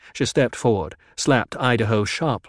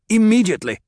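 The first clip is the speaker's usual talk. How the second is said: louder, higher curt word; cutting command; 11 semitones higher, 7 dB louder, faster than usual. A narrator's reading. Jessica overriding the doctor's protest, curt and loud. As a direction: cut him off sharply.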